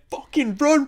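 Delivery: shrieking very high and loud; frantic shout. Retold or imitated. Friend yelling at Bella to flee, frantic shriek. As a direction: scream the warning in a panic. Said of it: "I'd be saying".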